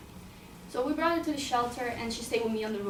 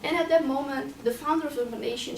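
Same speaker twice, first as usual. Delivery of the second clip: ordinary delivery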